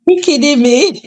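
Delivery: high, incredulous you kidding me; hammed-up disbelief; mock disbelief. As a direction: act shocked and amused. Imagined disbelieving reply.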